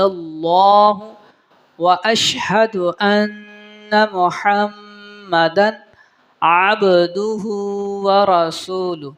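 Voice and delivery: slow, careful Quranic-style chant; slow recitation. Acted. Teacher reciting the prayer slowly for students. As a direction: recite each word slowly, measured.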